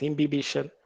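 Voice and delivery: "imbibition" with short i, im-bi-BISH-un; "imbibition" with no "bye" in it; alternative pronunciation. Shown as a demonstration. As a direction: say "imbibition" as im-bib-ISH-un. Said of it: "You might pronounce it as".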